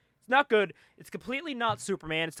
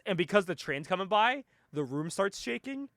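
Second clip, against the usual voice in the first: ordinary talk, nothing marked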